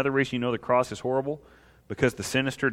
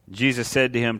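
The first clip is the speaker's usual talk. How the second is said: usual speaking voice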